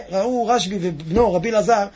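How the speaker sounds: normal talking voice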